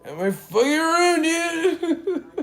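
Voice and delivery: very high loud whine; bratty screech. Retold or imitated. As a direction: shriek it like a spoiled teen. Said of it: "talking like that".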